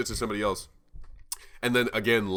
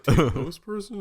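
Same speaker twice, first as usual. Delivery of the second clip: mock onlookers; 8 semitones higher, 3 dB louder, faster than usual. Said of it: "is like".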